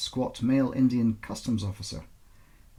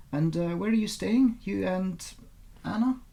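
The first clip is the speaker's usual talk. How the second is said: higher, lightly voiced young officer probing; a light higher police officer voice, probing; PC Renfrew; 9 semitones higher than usual. A narrator's reading. PC Renfrew questioning Elena about her daughter, higher and probing.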